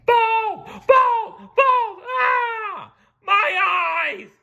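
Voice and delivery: very high screaming crowd. A narrator's reading. Customers seeing the bald head, screaming. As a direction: shriek in horror. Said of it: "screamed a crowd of customers".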